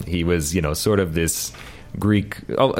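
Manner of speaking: usual speaking voice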